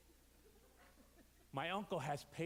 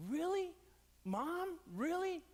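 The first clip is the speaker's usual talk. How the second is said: high, loud exasperated "Really? Mom? Really?"; exasperated cry, much higher and louder; upset disbelief; 11 semitones higher, 4 dB louder, slower than usual. Retold or imitated. Son scolding his mother at dawn, exasperated high cry.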